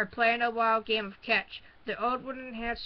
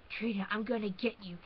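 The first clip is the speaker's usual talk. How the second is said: growl; 6 dB quieter than usual. Acted. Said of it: "he growled".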